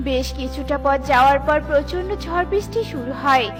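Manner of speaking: plain reading voice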